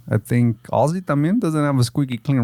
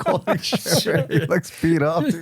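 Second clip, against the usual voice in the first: surprised; 4 semitones higher, slower than usual. Retold or imitated. Shocked at old photo.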